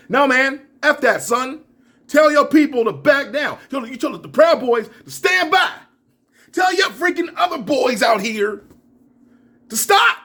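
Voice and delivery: shouting at Trump to stop his supporters, loud and high; angry call-out. Real, not acted. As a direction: order him to call them off, loud and high. Yelling at Trump to act.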